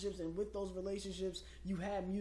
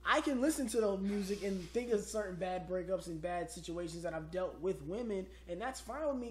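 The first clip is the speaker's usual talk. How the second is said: ordinary delivery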